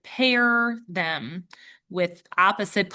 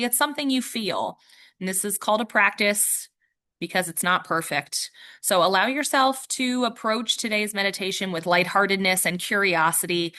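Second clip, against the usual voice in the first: ordinary delivery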